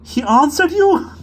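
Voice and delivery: high, loud, slow and breathless with giggles; a boy helpless with laughter; gasping laughter. A narrator's reading. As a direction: gasp it out between laughs. Max gasping through laughter.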